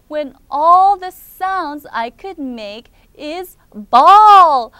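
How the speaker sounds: very slow, loud storybook wail; bawling lament. Acted. Rookie complains to Cookie about his voice, loud acted lament. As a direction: drag it out and swell loudly on "bawl".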